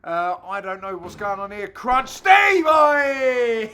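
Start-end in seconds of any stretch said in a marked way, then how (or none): 1.7-2.7 shouted high and loud; sudden commentary yell; excited shout. Real, not acted. 2.7-3.7 shouted high and loud; sudden commentary yell; excited shout. Real, not acted. Reacting to the shot.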